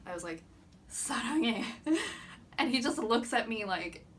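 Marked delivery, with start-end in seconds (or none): 0.9-2.0 reenacted love confession. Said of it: "reenact the face I made".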